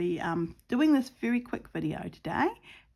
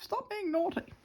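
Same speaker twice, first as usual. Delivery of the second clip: sing-song scolding, much higher and softer; high soft mock scold to the puppy; mock telling off; 12 semitones higher, 5 dB quieter than usual. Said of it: "Well, I tell her off".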